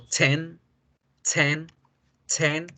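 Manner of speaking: "ten" with a hard T; strong T. Shown as a demonstration. Teacher showing the forceful T in ten. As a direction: punch the T in "ten". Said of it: "hago fuerza en el, en la T".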